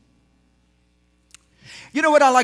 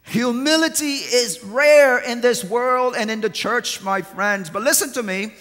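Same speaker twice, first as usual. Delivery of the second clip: full-force preaching; 3 semitones higher, 4 dB louder than usual. Acted. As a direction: drive each phrase home to the church.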